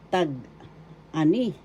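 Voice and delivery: "Tag-ani" with a clear gap after "Tag"; slow, split word. Shown as a demonstration. Teacher introducing tag-ani to learners, slow and split. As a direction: say "Tag", pause, then "ani", slowly. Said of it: "First syllable".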